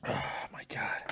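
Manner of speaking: whispered in real pain, barely voiced. Real, not acted. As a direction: breathe it out, too burnt to speak. Mouth burning from the pepper.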